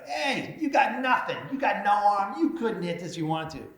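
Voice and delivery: high, loud cocky taunt. Retold or imitated. Mayor taunting officer at the dunk booth, loud and cocky. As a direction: goad her like a smug schoolkid. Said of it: "and I taunt her".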